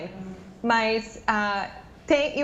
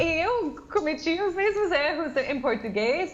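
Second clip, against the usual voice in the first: laughing; 5 semitones higher than usual. Real, not acted. Laughing while admitting mistakes.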